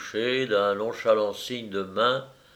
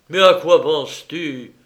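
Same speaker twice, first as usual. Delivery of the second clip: loud, higher, flaring up; angry outburst; 4 semitones higher, 8 dB louder than usual. A narrator's reading. Baron, losing his temper with Anna: loud angry outburst. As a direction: snap back in a burst of temper. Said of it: "s'écria le baron en s'emportant".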